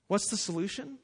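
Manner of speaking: normal talking voice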